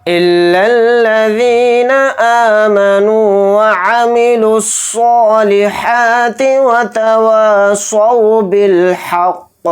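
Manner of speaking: slow, drawn-out tajweed chant; Quran recitation. Shown as a demonstration. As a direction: recite it slowly and melodically. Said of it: "तो ध्यान से देखें".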